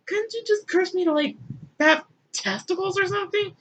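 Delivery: high, pleading mock whine; hammed-up whining complaint; whiny Adam. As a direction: beg like a put-upon man. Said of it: "Adam is like".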